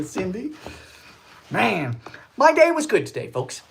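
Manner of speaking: ordinary talk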